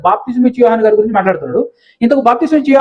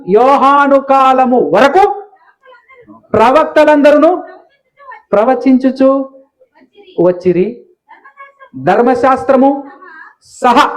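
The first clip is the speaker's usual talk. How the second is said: drawn-out, raised recitation; slow scripture reading; 3 semitones higher, slower than usual. Shown as a demonstration. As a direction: stretch each word as if reading aloud to a class. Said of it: "ఇక్కడ ఏమంటున్నాడు?". (నెమ్మదిగా వచనం చదవడం)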